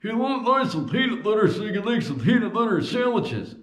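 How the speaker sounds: put-on creaky old geezer; old man voice. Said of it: "do an old man voice".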